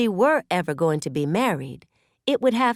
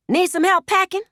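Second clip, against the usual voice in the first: high, louder sarcastic jab; mocking shot back; 13 semitones higher, 5 dB louder than usual. A narrator's reading. Woman mocking her leaving man, high and sarcastic. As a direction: needle him with a sweet, high offer. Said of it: "Knot shot back".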